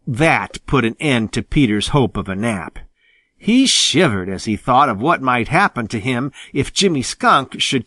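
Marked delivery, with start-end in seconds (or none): none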